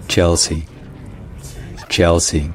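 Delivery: "Chelsea" said the English way, CHEL-see; English "Chelsea" with a dark L; English pronunciation. Shown as a demonstration. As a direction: start "Chelsea" with tch, stress the first syllable. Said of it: "accent tonique sur la première syllabe".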